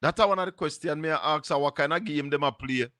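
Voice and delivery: usual speaking voice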